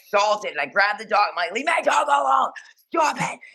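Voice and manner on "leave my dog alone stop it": high-pitched re-enacted yell; screaming. Teller grabs her dog from the drunk kid, re-enacted screaming. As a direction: shriek it to protect your dog. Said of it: "'cause I'm screaming".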